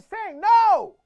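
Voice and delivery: hammed-up yelling match; shouted back and forth. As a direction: bandleader and singer yelling over each other.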